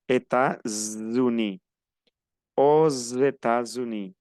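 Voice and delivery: États-Unis slow, s linked as z; liaison with z. Shown as a demonstration. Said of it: "como si fuera una Z". (Aux États-Unis con z, despacio)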